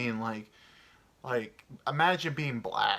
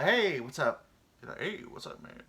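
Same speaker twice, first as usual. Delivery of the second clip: put-on dude voice; 4 semitones higher than usual. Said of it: "racist voice".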